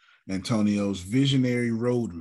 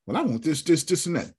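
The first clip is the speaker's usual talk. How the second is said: mock demanding customer; 8 semitones higher than usual. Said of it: "Trinae, how can we help you".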